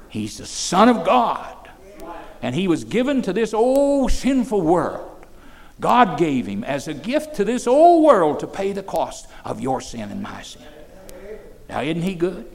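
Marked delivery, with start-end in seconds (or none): 0.1-10.6 loud, ringing pulpit proclamation; preaching at full force. Acted. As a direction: preach it out to the room. Proclaims the Son of God.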